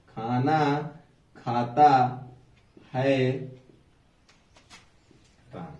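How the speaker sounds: words spaced out with pauses. Shown as a demonstration. Teacher showing a jumbled sentence, words spaced out. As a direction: place each word apart. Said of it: "ये क्या है बेटा?".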